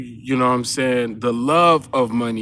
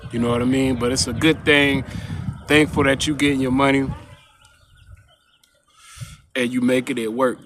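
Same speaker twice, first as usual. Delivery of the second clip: usual speaking voice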